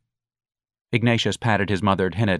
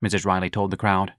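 ordinary delivery

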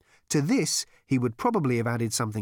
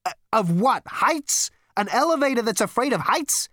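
loud, high, disbelieving questions; incredulous cry; 13 semitones higher, 6 dB louder than usual. A narrator's reading. Zaphod scoffing at a frightened elevator, incredulous cry. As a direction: exclaim in high-pitched disbelief. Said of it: "cried Zaphod".

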